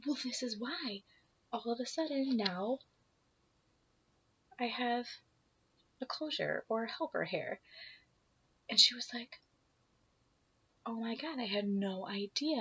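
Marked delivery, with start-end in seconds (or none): 0.1-7.6 hushed. Retold or imitated. Confiding secret in a stall. 10.9-12.6 hushed. Retold or imitated. Coworker's hushed shocked reply.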